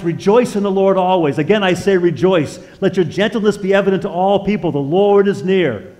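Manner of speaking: preacher reading Paul aloud to his listeners, proclaiming; proclaiming scripture. Acted. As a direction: declaim it to the congregation. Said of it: "Paul writes".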